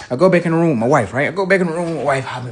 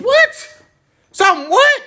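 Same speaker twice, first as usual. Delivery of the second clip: shrill high what, much higher; jolted awake and baffled; startled disbelief; 5 dB louder than usual. Retold or imitated. Woken father reacts to daughter, shocked high-pitched disbelief.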